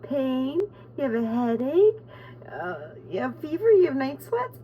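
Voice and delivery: lightly voiced app list; reading the app list. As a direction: rattle off the symptoms like the app. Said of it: "It was like".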